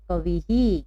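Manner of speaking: कविही ending in a clear "hi"; visarga after i. Shown as a demonstration. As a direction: model it for students. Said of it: "तो हम इसको क्या पढ़ेंगे?". (कविही, अंत में ही)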